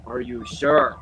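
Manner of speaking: building irritation without getting angry; slightly annoyed. Shown as a demonstration. Demonstrating a character's voice for a castmate, slightly annoyed. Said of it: "starting to build up anger, but you're not angry".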